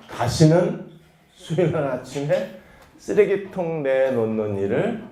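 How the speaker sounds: ordinary talk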